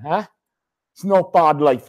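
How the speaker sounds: ordinary talk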